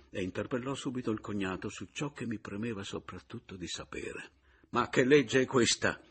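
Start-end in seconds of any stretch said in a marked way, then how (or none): 4.7-6.0 raised, loud protest; loud, exasperated question; indignant outburst. A narrator's reading. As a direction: burst out in disbelief at the law. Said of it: "proruppi ancora una volta".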